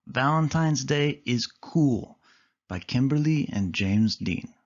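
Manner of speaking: put-on bright storybook narration for kids; reading to children. A narrator's reading. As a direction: read it like story time for little ones. Reading a picture book aloud.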